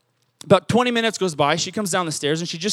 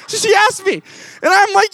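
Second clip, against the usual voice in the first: laughing; 11 semitones higher than usual. Real, not acted. Laughing while retelling rejection.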